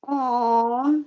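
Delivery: high, drawn-out patronizing "aw"; mock pity. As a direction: coo with syrupy sympathy, mimicking onlookers. Mimicking pitying onlookers at her cane.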